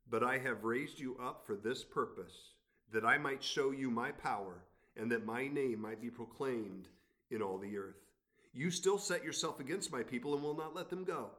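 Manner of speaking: lifted, measured Bible reading; scripture reading. Acted. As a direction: read God's words to Pharaoh with weight.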